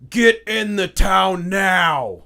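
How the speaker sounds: loud, high, drawn-out command; soldier's yell. Acted. Soldier warning travellers to take cover, urgent yell. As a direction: yell the order across a distance. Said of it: "just yell at you".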